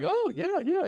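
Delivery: a hint of a doctor humoring him; mock high-pitched distracted yes; doctor agreeing. Doctor reacting to knife sales.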